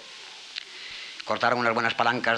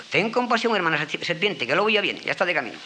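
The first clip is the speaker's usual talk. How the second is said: lightly voiced fox; 8 semitones higher, 3 dB louder than usual. Fox reassures serpent again.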